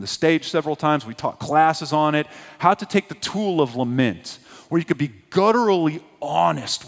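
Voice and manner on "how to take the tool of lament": ordinary delivery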